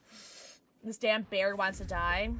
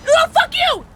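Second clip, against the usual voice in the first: high, very loud scream; real outraged shriek; furious shout; 17 dB louder, slower than usual. Real, not acted. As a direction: scream it at the opponent. Killed in game, yells back.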